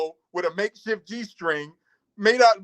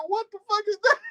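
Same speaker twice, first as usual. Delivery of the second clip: shocked shout. Retold or imitated. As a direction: yelp it out in disbelief. Retold shock seeing cellmate.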